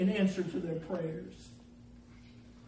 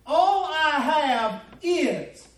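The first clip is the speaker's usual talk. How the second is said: raised voice, slowing into drawn-out phrases; forceful preaching; 4 semitones higher, 6 dB louder than usual. Acted. Preacher voicing how the woman sees it, forceful preaching. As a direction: preach it with mounting volume.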